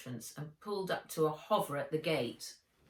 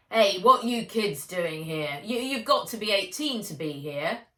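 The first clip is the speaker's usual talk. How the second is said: loud, gruff stable hand barking at intruders; 3 semitones higher, 9 dB louder than usual. Acted. Thin stable man shouts at the kids at the gate, gruff and loud. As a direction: challenge the kids loudly and roughly. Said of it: "he said in a gruff voice".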